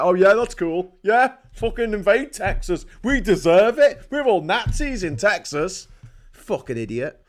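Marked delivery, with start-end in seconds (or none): 0.0-5.9 loud, mocking sarcasm; sarcastic Texan. Mocking an American who defends Putin, loud sarcasm. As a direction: sneer it out like a dumb Texan agreeing. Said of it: "you'll be like".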